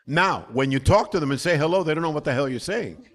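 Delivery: ordinary talk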